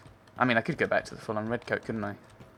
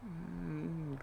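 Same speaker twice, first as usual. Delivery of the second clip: usual speaking voice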